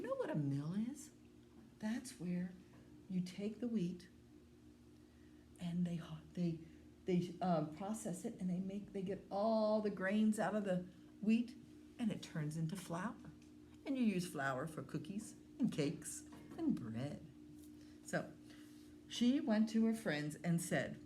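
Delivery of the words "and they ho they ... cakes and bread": usual speaking voice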